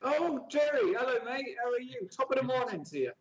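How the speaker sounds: high, hammed-up Irish greeting; exaggerated high-pitched Irish accent; put-on Irish accent. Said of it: "Your Irish accent, you're so bad".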